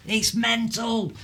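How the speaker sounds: high, drawn-out exasperation; slow, high-pitched indignation; exasperated outburst. Real, not acted. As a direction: rant it in disbelief, pitch jumping up. Exasperated rant against Brexit voters.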